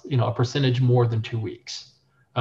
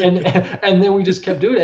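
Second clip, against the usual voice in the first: laughing; 6 semitones higher, 6 dB louder than usual. Real, not acted. Laughing recalling success.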